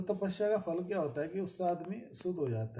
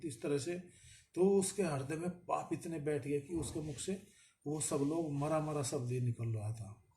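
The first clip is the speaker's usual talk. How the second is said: ordinary talk, nothing marked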